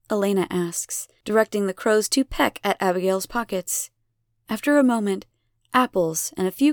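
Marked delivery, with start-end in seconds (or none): none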